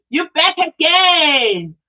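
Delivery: loud, high, drawn-out welcome; excited greeting. Real, not acted. Host welcomes a returning viewer back, excited greeting. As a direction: stretch it out in delighted surprise.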